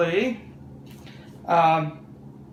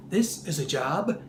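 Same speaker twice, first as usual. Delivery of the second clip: lower, softer everyman voice; Clark Kent; 6 semitones lower, 6 dB quieter than usual. Acted. Cartoon Clark Kent while changing, low soft voice. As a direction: keep it low and soft, mild-mannered alter ego. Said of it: "and he would say".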